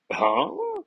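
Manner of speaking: squeaky very high baffled huh; a cartoonish startled yelp of confusion; stunned huh. Thor reacts to being slapped, squeaky high bafflement.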